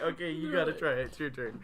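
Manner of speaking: ordinary delivery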